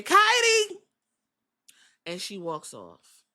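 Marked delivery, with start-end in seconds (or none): 0.0-0.8 high, loud call across the room. Retold or imitated. Asuka shouting across the backstage for Kairi, high and loud. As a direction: shout her partner's name. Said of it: "you can hear Asuka say".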